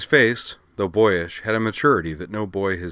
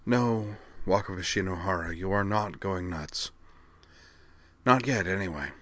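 soft, measured reply after a sigh; lightly voiced little mage, quietly patient; 5 dB quieter than usual. A narrator's reading. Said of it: "The little man sighed".

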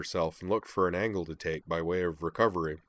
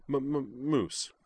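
slow, stuttered introduction; stammered name; 6 semitones higher, slower than usual. Acted. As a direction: trip on the first sound twice. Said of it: "Kate's stutter".

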